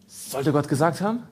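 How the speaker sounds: a hint of a sly tempter, slowed down; lightly voiced Satan testing Jesus; Satan's question. Satan tempting Jesus in the desert, lightly sly voice. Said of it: "die Worte Satans gegen das Wort Gottes".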